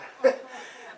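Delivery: short laugh at own joke; chuckle. Real, not acted. Talk show host amused by his coffee joke, short chuckle. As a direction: chuckle at your own gag.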